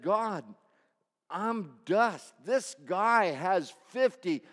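loud, high desperate plea to God; desperate king praying. Acted. King of Israel on his face before God, desperate loud plea. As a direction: beg like a cornered king.

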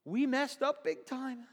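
high, panicky guard voice; panicked guards. Acted. Guards panicking among themselves, high frightened voice. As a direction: play the frightened soldier, pitched high. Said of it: "they're all going to themselves".

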